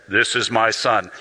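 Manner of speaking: deep, slow divine voice; voice of God. Acted. Preacher voicing God at the baptism, deep and slow. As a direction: drop low and slow as if God speaks. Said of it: "the voice of God says this".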